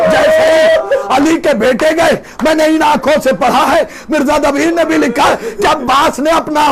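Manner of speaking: full-force lament. Acted. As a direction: shout the grief out to the gathering.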